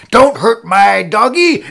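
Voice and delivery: high, loud, drawn-out cry; outraged dog owner. Acted. Dog owner raises broom at cat owner, outraged slow shout. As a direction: holler slowly in your pet's defence.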